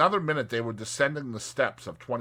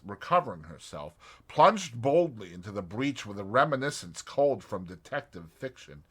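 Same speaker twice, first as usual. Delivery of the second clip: usual speaking voice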